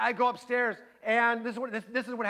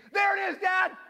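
put-on high, loud kid's shriek of alarm; mock-panicked high yell; imitated scream; 10 semitones higher, 5 dB louder than usual. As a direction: scream it like a startled kid. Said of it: "one of them will scream".

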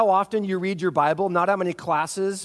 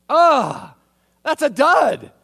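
much higher and louder let-down groan; disappointed groan; 6 semitones higher, 7 dB louder, slower than usual. Retold or imitated. Listener hearing the anticlimactic command, groaning. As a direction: groan like the joke just bombed. Deflated reaction to the punchline.

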